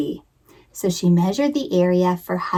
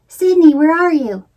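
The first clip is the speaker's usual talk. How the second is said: higher, louder call across room; 7 semitones higher, 6 dB louder than usual. Acted. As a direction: call for the hidden bird. Calling out to the bird.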